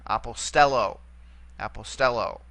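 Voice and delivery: aposTELlo, accented next to last syllable; stress on penult. Shown as a demonstration. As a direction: hit the stel in apostello. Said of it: "only going to let us go back to the".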